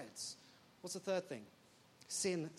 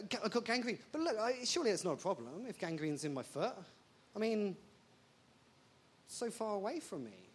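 ordinary talk, nothing marked

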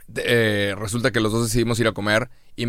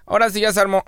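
put-on high, singsong Mexico City accent; hammed-up chilango podcast welcome; mock Mexico City accent, pitched way up; 9 semitones higher, faster than usual. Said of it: "cambiar nuestro acento".

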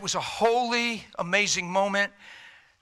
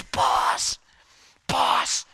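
urgent whisper; 5 semitones higher than usual. Acted. Demon runs back whispering.

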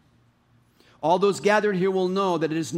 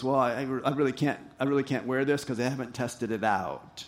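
polite refusal; 6 semitones lower, 5 dB quieter than usual. Retold or imitated. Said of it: "David just politely goes".

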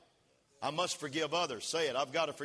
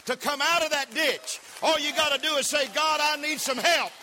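preaching at full force; 12 semitones higher, 9 dB louder than usual. Acted. Pastor shouting to the congregation, full force. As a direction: build up and shout it to the church.

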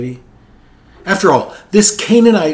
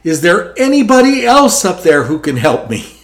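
higher, louder comic yell upward; 6 semitones higher, 5 dB louder than usual. Retold or imitated. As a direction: shout up the cliff for someone else. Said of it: "and yelled".

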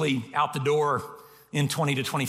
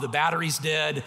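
ordinary talk, nothing marked

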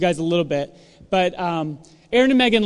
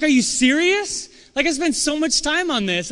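mock indignant disbelief; 8 semitones higher, 3 dB louder than usual. You reacting to a friend's shrug, indignant. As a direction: act hurt and incredulous. Hurt reply to unimpressed friend.

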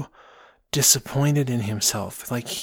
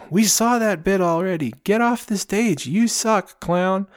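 much higher mocking yell; heckler jeer; 8 semitones higher than usual. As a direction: sneer at the repeated bit. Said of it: "one of those two voices is like".

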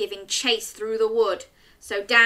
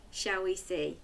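ordinary delivery

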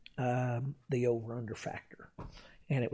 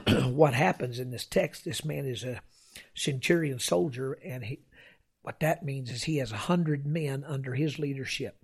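ordinary delivery

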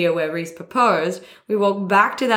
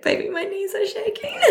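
higher and laughing through the line; can't hold back a giggle; giggly retelling of his nervous words; laughing while quoting; 12 semitones higher than usual. Real, not acted.

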